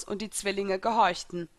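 ordinary delivery

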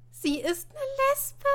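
high, loud character voice; blurting it out. A narrator's reading. Ina answers in Martin's place, blurting it out high.

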